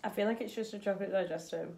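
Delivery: chewing while talking; talking between bites; mouth full. Real, not acted. Seen 1: Both eat biscuits, hand at mouth; unclear which one speaks.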